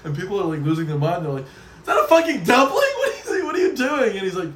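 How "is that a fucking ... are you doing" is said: shocked shout. Retold or imitated. Crowd reacts to dumpling.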